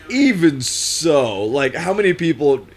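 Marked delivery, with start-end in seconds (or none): 0.1-1.4 drawn out. Real, not acted. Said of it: "insisting again".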